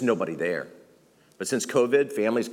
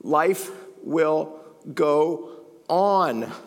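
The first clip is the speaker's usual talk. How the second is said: slow drawn-out refrain; 4 semitones higher, much slower than usual. Real, not acted.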